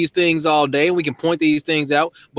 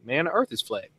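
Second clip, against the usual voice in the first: sheepish admission; 2 semitones lower, 9 dB quieter than usual. Retold or imitated. Former skeptic quietly admitting to the man he embarrassed. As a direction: confess it quietly, a little embarrassed. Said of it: "you like".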